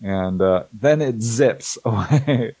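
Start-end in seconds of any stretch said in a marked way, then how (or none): none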